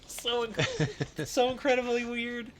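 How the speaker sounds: high, slow, choked by laughter; really cracking up, pitch climbing; helpless laughter. Real, not acted. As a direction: struggle to get the words out through laughing. Laughing too hard to speak.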